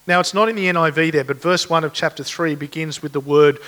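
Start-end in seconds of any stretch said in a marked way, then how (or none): none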